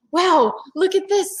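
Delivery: put-on high excited little girl voice; Annie's voice. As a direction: read it as eager young Annie. Annie voiced in read-aloud.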